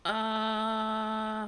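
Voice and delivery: drawn-out, level uh; long hesitant uh. Real, not acted. Cohost stunned by the dog joke, drawn-out hesitation. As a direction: stall on one long, held note.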